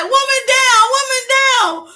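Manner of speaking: very high, loud mock emergency call; hammed-up distress cry; mock alarm. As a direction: shout it like a downed soldier.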